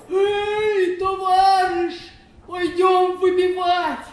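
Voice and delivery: loud, drawn-out call to a mate. Acted. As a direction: holler it slowly to someone outside a window. Товарищ зовёт под окошком. (громкий протяжный оклик)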